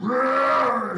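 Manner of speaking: loud, drawn-out angry roar; mean roar. Acted. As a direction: roar like a mom losing her temper. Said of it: "she mean again".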